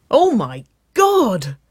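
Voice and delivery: high, loud, drawn-out yelp. A narrator's reading. Sister thrilled at the date news, yelping. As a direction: yelp each stressed word in delight. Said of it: "Wynona yelped".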